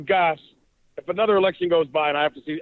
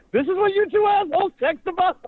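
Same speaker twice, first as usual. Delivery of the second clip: higher, mock-indignant wife; mock wife outrage. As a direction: play the shocked wife, pitched up. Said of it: "she goes".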